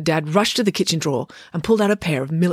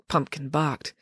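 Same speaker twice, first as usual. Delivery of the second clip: usual speaking voice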